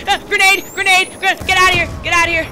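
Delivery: high, loud warning yell; grenade warning yell. Real, not acted. Grenade thrown at him.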